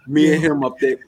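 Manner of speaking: ordinary delivery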